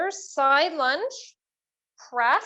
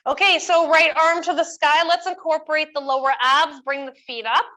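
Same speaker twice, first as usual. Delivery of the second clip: fitness instructor; 3 semitones higher, 6 dB louder than usual. Acted.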